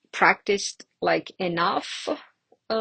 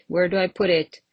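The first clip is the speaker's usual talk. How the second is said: ordinary talk, nothing marked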